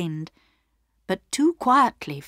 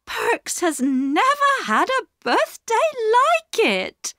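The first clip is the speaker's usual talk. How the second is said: very high-pitched astonishment; high, wonderstruck exclamation; amazed wife. A narrator's reading. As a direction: marvel at the presents in a high character voice.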